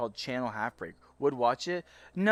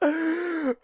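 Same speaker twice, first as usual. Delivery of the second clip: high giggling with a woo; high laughter; 13 semitones higher than usual. Real, not acted. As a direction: giggle and whoop. Still laughing.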